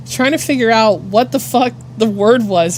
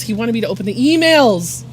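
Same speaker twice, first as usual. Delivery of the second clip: ordinary talk, nothing marked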